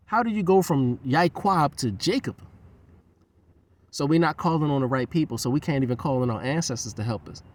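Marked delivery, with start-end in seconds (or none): none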